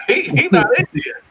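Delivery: high-pitched, real chuckle through the words; amused, breaking into laughter; laughing. Real, not acted. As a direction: giggle while saying it. Laughs at his own retort.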